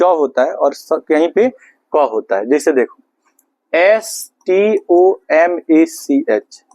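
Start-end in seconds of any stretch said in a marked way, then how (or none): 3.7-6.6 very slow, letter by letter; spelling out. Shown as a demonstration. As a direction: spell it out slowly with clear gaps between letters. Spelling the word out for students.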